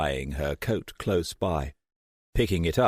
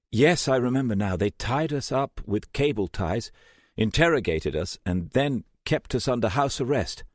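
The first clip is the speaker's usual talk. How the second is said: higher, quicker, a burst of recall; lightly voiced Jessica, suddenly sure; Jessica remembering; 7 semitones higher than usual. A narrator's reading. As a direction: let it tumble out as it comes back.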